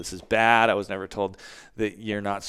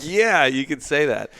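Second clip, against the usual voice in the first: wry understatement; 6 semitones higher, 7 dB louder than usual. Retold or imitated. Sarcastic reply to friend.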